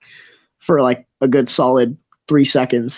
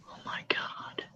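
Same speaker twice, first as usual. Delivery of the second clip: barely audible whisper; exasperated whispered oath; swearing under breath; 23 dB quieter, slower than usual. Acted. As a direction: whisper a curse to yourself. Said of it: "swearing under his breath".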